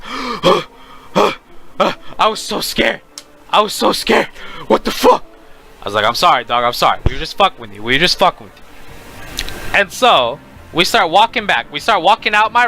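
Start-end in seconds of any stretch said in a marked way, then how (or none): 0.0-5.2 gasping between phrases, breathless; imitated shaken panting. Friend being patted on the back after scare, breathless. 6.0-8.6 calm a shaken buddy, voice dropped low; retold soothing apology. Storyteller apologising to his shaken friend, low and soothing.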